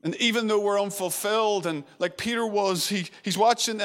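ordinary talk, nothing marked

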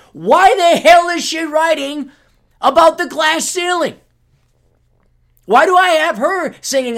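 shouting in disbelief; exasperated shout. Real, not acted. Scene: ranting at the reporter's choice of topics, exasperated shout.

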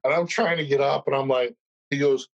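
normal talking voice

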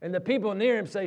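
ordinary talk

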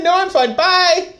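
breezy brush-off. Retold or imitated. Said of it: "Augustus is like".

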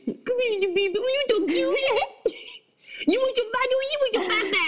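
put-on very high cooing baby-talk voice; squeaky coo at a newborn; high sing-song mocking baby talk to a three-month-old. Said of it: "So you don't need to be saying".

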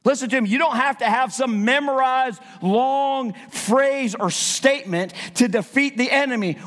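fired-up sermon voice; impassioned preaching. Real, not acted. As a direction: preach it with force, punching key words. Preaches at full force.

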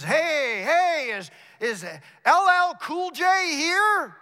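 high, loud holler down a hallway; calling out. Retold or imitated. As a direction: bellow it out, drawing out each word. Big guy calling down hospital hallway.

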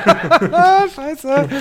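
high-pitched laughing curse; laughing despair. Real, not acted. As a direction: laugh it out in disbelief. (lachend verzweifelt fluchen)

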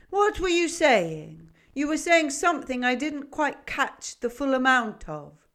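put-on old goat demanding a repeat; gruff goat voice. A narrator's reading. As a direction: boom the question like a half-deaf goat. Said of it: "A voice gruff and cobwebby".

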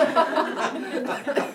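high burst of real laughter. Real, not acted. Speaker laughing at own TV binge, real high laugh. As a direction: laugh at yourself.

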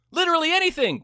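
exaggerated high-pitched squeal; high squeak. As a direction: leap up high on literally anything.

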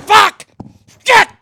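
real outburst at full volume; furious shout. Real, not acted. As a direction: blow up at the gear that won't work. Real outburst at broken equipment.

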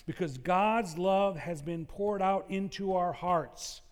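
slowed, weighty delivery of "God's love has been poured out"; slow preacher's emphasis. Acted. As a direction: lean on every word of "God's love". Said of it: "here is the powerful imagery".

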